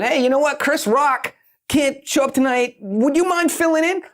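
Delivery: high excited stranger. Retold or imitated. Random person asks comedian to fill in.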